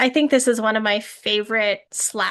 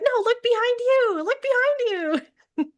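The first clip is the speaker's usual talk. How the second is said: watchers' mock warning; 13 semitones higher than usual. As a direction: squeal a warning like a helpless film audience. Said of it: "as the watchers, we're like".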